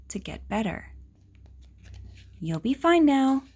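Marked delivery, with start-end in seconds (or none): 2.4-3.4 louder, higher reassuring voice; cheery reassurance. Acted. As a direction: tell the patient brightly they'll be fine. Doctor reassures patient.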